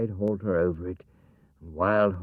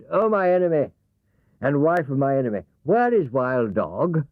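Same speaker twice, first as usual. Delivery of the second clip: higher, louder, urgent horse; Wild Horse; 7 semitones higher, 5 dB louder than usual. Acted. Wild Horse demands of the woman, higher and louder. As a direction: demand the answer, slowing at the end.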